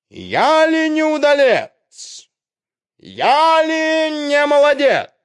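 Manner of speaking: high, loud, boastful rooster voice; proud rooster. Acted. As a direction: strut and boast like a cock.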